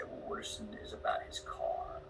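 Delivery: quiet whisper to himself; saying it to himself. Retold or imitated. Actor retelling his inner thoughts, whispered. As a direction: think it aloud in a whisper. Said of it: "And to myself, I say".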